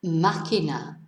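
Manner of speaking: "Máquina" as MA-ki-na, first syllable stressed. Shown as a demonstration. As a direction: model "Máquina" with weight at the start. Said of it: "tells us to stress the first syllable".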